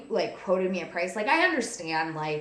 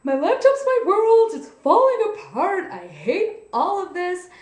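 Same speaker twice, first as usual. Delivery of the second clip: much higher and louder, a frantic melodramatic whine; high-strung wail; 13 semitones higher, 11 dB louder than usual. Retold or imitated. Reliving her panic over the broken laptop, high-strung frantic wail. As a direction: wail it like your whole world is ending. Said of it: "high strung 'cause I was like".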